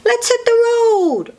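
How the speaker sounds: high, excited little truck voice; truck character. A narrator's reading. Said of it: "says Blue".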